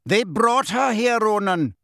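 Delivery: high, forceful objection; arguing centaur. A narrator's reading. Centaur holding Harry, arguing with Ronan.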